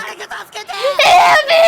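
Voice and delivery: high desperate scream; screaming for help. A narrator's reading. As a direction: scream it out in panic. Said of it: "Though it had been closer to a scream".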